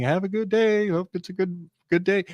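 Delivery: lightly imitated high-pitched call; mock calling out. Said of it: "he just yells ambiguously into the air".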